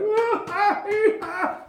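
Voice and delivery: high, hammed-up whooping laugh; dragon king's cackle. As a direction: cackle like a gleeful tyrant. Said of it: "And he's like".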